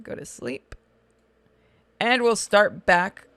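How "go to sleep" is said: low unvoiced whisper. Real, not acted. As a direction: hush it under your breath. Whispered aside.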